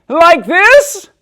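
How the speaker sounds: put-on high, loud, eager girl; loud child voice. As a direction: still booming when told to hush. Loud girl asked to be quieter.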